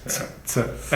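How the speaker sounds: S, S as a soft unvoiced ts hiss; hissed ts sound. Shown as a demonstration. Teacher repeating the ts sound for learners. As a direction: breathe out a quick ts, S, S. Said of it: "The T S in cats".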